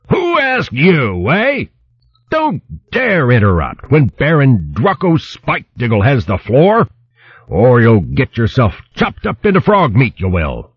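high-pitched angry hedgehog bluster; roaring. A narrator's reading. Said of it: "the big hedgehog roared".

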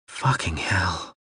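hushed breathless whisper. A narrator's reading. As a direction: breathe the curse out, barely voiced. Shocked swear at dying comrade.

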